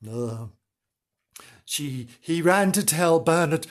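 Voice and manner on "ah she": ordinary delivery, nothing marked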